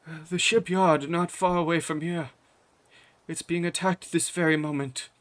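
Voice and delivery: Father Ekjar. Acted. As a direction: deliver urgent tidings to the king. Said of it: "he turns his attention towards the king".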